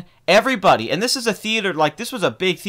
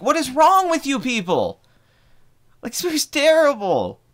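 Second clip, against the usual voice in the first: high-pitched, indignant retold outburst; exasperated disbelief; 10 semitones higher than usual. Moviegoer appalled at applauding crowd, exasperated disbelief. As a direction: plead with a crowd that makes no sense, voice pitched up. Retold outrage at the clapping audience.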